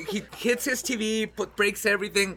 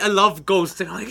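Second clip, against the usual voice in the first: usual speaking voice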